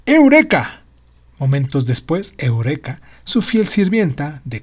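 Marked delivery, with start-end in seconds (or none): 0.0-0.8 high joyful cry of triumph; joyful shout. Acted. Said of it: "gritó".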